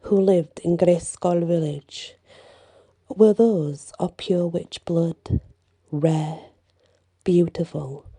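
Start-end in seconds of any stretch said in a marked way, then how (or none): none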